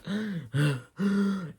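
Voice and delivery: sharp, high imitated gasp; mock gasp. Agatha stepping out of the trial, mock gasp. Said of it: "and she's like".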